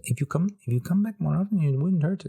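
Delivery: high, fast imitation of the waxer; waxer's voice impression. Mocking the waxer's line, a high fast impression. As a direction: squeak it out like the waxer. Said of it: "I wish I could do the voice".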